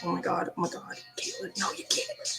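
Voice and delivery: low, soft imitated pleading; hushed retold dismay. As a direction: mutter it under your breath, aghast. Retold hushed plea to daughter.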